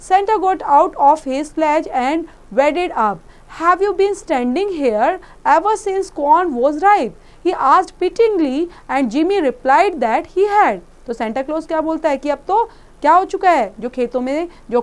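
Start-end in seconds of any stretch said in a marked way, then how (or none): 0.1-10.8 put-on slow, deliberate read-aloud for children; slow story reading. A narrator's reading. Teacher reading the story aloud slowly to the class. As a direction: read each word out slowly and clearly.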